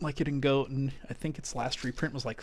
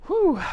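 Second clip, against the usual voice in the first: loud breathless whew; exhausted whew; 10 dB louder than usual. Real, not acted. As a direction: blow out a tired whew. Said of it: "Gotta catch my breath".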